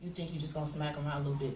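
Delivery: ordinary talk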